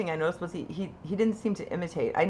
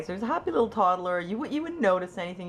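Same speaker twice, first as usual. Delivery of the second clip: ordinary talk, nothing marked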